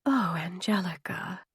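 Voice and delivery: soft whispered "Oh, Angelica"; hushed, stunned whisper; breathed whisper. A narrator's reading. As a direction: breathe it out in shock. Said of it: "I breathed".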